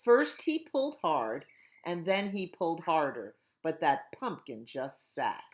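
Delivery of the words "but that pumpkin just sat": ordinary delivery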